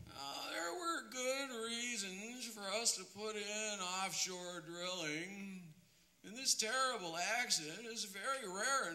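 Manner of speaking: mock the boss in his own smug tone; impression of an oil CEO. Mimicking the BP chief for the crowd, lightly put-on voice. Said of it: "I'm gonna try to do it in the voice in which it was probably said".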